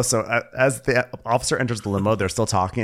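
usual speaking voice

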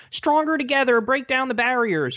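high, sing-song parody of a campaign slogan; mocking slogan. Host mocking Hillary's campaign slogans in a sarcastic sing-song.

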